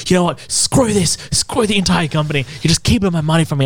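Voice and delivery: high-pitched mock fury, quoting the customer; mock angry rant. Angry customer quoted on phone.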